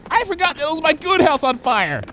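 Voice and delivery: high frantic pony squeal; panicked squeal. Acted. Bon Bon squealing in panic racing through the store. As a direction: blurt it out in a panic.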